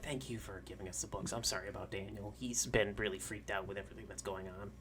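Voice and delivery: soft, low, confiding voice; apologetic daycare lady. Acted. Daycare lady apologises to Vincent about Daniel, soft low voice. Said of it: "she's like".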